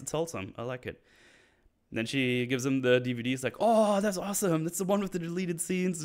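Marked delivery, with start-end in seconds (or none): 3.6-6.0 high, gushing retelling; excited gratitude. Retold or imitated. Father gets DVDs from daughter, excited. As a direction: act thrilled, pitched well up.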